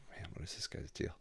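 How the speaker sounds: whispered under the breath, half to himself; muttered to himself. Retold or imitated. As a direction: breathe it out as a private thought. Inner thought about couch guy.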